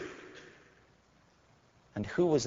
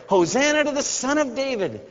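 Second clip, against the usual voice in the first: very high, bright acclaim; children shouting; 12 semitones higher, 4 dB louder than usual. Retold or imitated. Children shouting in the temple, very high. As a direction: ring it out like a crowd of kids. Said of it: "the children shouting".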